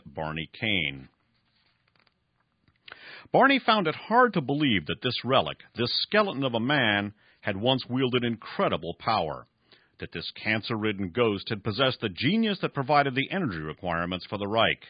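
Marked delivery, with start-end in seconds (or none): 3.3-14.8 measured, lifted storyteller read; host reading a novel to listeners. A narrator's reading. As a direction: read the scene like a radio thriller narrator. Reads novel narration aloud.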